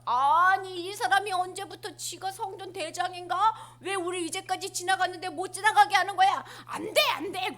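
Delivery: high, loud complaining crowd; indignant protest. Acted. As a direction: push back, offended.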